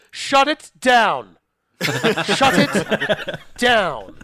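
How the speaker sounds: mock official's order. Co-host playing Thornberg shutting down the restaurant, mock stern order. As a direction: bark the shutdown order like a petty official, then crack up. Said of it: "and he said".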